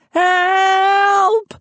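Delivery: long stretched high yell; calling out. A narrator's reading. Moose yelling toward the house, panicked. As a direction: drag the help out as a shout. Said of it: "Moose called".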